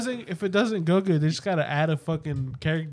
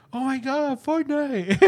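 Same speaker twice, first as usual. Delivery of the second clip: put-on kid's excitement; 9 semitones higher, slower than usual. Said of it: "and he goes".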